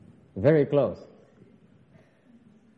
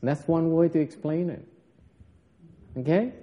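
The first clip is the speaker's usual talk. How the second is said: ordinary talk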